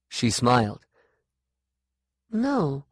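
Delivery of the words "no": high, quick "no"; a child protesting; girl's denial. A narrator's reading. Sean denying to the woman, high. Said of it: "Sean said".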